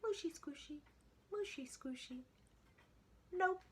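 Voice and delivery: high, squealy piglet voice, defiant; piggy voice. A narrator's reading. The piggy answering her family, high piggy voice. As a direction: sing it out like a stubborn little pig. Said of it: "piggy answered".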